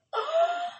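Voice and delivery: high, excited gasp; fangirl gasp. Retold or imitated. Fan reacting to mom's forwarded text, excited gasp. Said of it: "I'm over here fangirling".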